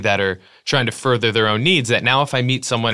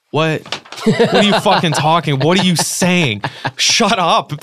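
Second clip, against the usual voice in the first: incredulous outburst; 5 semitones higher, slower than usual. Real, not acted. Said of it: "outraged reaction".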